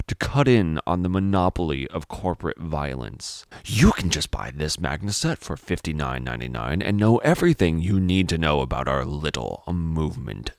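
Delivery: usual speaking voice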